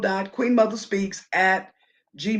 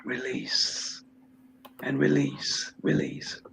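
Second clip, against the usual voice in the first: guided breathing. Acted. Leads breathing exercise.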